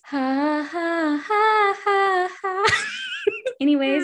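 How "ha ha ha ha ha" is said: rising, high-pitched fake moans; mock climax panting. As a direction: pant higher and higher in a hammed-up climax. Climax of the mock love scene.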